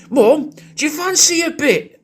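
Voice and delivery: hammed-up son calling to mum upstairs; mock shout up the stairs. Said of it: "son's shouting up the stairs to you going".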